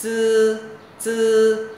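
each "chư" a loud, high, sustained "ts" syllable; tongue to front teeth, hold "chư" level and strong; tongue-tip dental sound. Shown as a demonstration. Teacher drills the sound for students, tongue-tip dental sound. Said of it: "đầu lưỡi chạm nhẹ răng cửa thôi".

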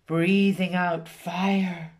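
plain reading voice

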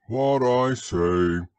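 loud, slow, ponderous donkey; gloomy Eeyore. A narrator's reading. Donkey objects to the expedition, slow grumble. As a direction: drag out each word loudly.

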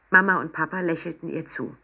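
ordinary delivery, nothing marked

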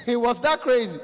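ordinary delivery

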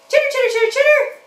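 high, chirpy critter squeak; squeaky puppet chatter. Pretend. Puppet chatters at the children, high and squeaky. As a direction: chatter like a small excited puppet animal.

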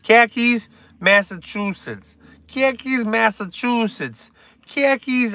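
exaggerated Massachusetts accent; Boston accent. Shown as a demonstration. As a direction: drill the words in a bad Boston accent. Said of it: "sounds like I'm from Massachusetts".

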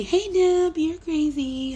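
high, singsong mock teasing; playful imitation of a viewer; imagined teasing viewer. Voices viewer's future teasing reaction.

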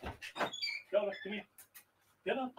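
hushed coaxing of the dog; quiet whispered calling to a pet. Real, not acted.

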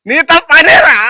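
high, loud comeback; exasperated retort. Real, not acted. Retort to companion's refusal.

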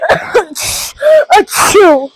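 loud sudden sneeze. Real, not acted. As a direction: let out a real sneeze. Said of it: "I think I gotta sneeze".

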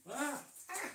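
startled yelps. Real, not acted. Nearly falls over.

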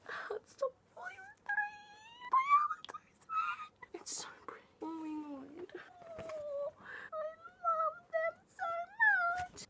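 breathless, high whispered gushing over the book. Real, not acted. Unboxing her favourite series, gushing in a high whisper. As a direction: squeal softly with delight.

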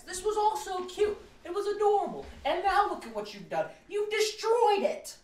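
very high, squeaky wailing; distraught lament. Real, not acted. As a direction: wail the accusation in mock heartbreak. Upset reader laments ruined sweetness.

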